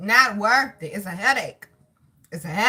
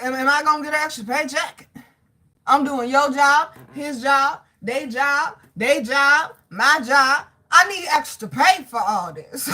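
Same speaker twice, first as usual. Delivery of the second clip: retold complaint, raised pitch and volume; higher, louder, spaced-out protest to a boss; indignant protest; 4 semitones higher, 4 dB louder than usual. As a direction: count off each job with exasperated emphasis. Retells protesting to her manager.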